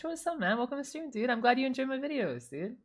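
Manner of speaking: high-pitched, soft greeting; high, light hello to a fan; friendly welcome. Real, not acted. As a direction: welcome them in a high, sweet voice.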